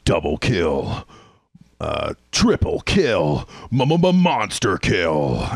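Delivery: slow, drawn-out game announcer. Retold or imitated. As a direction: boom out each kill call slowly. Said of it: "the default was".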